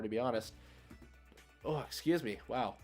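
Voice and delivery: ordinary talk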